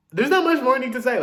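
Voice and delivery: loud, high emphatic verdict. Real, not acted. Telling viewers she's done talking, loud and emphatic.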